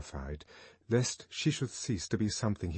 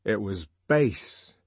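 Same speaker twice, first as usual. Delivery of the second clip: indignant; 5 dB louder, slower than usual. Acted. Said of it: "he said indignantly".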